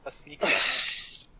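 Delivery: drawn-out s like a snake. Shown as a demonstration. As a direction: stretch the s in "sneaky snake" like a hiss. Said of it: "Sounds like a snake".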